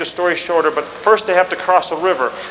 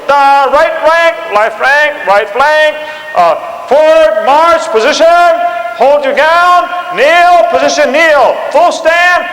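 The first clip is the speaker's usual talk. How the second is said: loud, high, shouted orders; an officer drilling the lines into position; barked drill commands; 8 semitones higher, 6 dB louder, much slower than usual. Retold or imitated. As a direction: call the ranks like a drill sergeant. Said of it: "You go".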